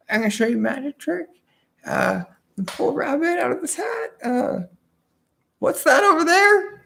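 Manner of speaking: high, fast hammed-up showman patter; mock magician. To the audience, a trickster magician fakes his trick, hammed-up. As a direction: play a cheesy stage magician stalling for time. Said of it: "the magician is like".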